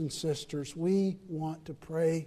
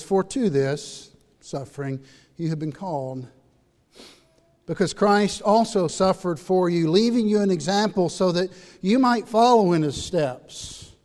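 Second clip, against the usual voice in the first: louder, higher scripture reading; scripture read with force; 4 semitones higher, 8 dB louder than usual. Acted. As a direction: read it out as a proclamation. Reading Peter aloud in sermon.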